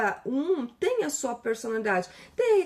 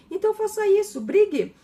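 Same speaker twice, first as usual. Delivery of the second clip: loud, higher push to argue; emphatic urging; 8 semitones higher, 6 dB louder than usual. Retold or imitated. Telling the client to fight with God, loud and high. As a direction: demand it boldly.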